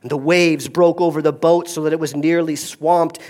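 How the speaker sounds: normal talking voice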